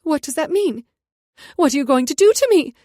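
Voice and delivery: high, loud, terrified pleading; captured woman panics at the guards, loud and high; panicked. A narrator's reading. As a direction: shriek it as panic sets in. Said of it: "Her eyes went wide".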